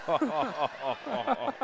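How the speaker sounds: high laughing "oh"; amused outburst at the play. Real, not acted. Reacting to stolen ball, laughing.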